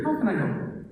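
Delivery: bright chirpy service-desk greeting; cheery. Shown as a demonstration. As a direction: sing it out like a cheerful clerk. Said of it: "it sounds very cheery".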